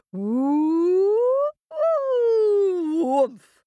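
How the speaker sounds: high swooping woo building to a loud woomph; rising woomph. A narrator's reading. Storyteller makes the mountain spring up, loud rising whoosh. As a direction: sweep the voice up high and burst it out loud.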